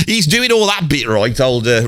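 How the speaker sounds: normal talking voice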